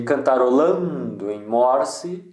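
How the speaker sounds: "cantarolando em morse" with "lan" and "mor" drawn out; stressed tonic syllables. Shown as a demonstration. Teacher marking two tonic syllables, long "lan" and "mor". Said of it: "são só duas sílabas tônicas".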